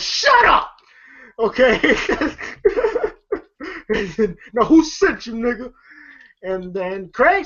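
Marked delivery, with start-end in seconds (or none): 0.0-5.7 shrieked mock yell, much higher, breaking into a laugh; mock furious yell. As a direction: scream it high like an unhinged pimp, laughing through it. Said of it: "and Big goes".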